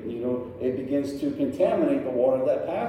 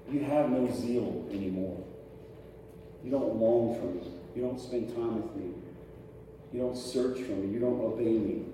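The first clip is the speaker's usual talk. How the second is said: softer, low grieving voice; quiet lament; 5 semitones lower, 7 dB quieter than usual. Acted. Jesus lamenting to the church, soft and sorrowful. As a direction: let it sink in, hushed.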